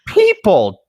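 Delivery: slow, high, loud disbelief; exasperated. Real, not acted. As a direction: drag it out in disbelief. Exasperated at the players.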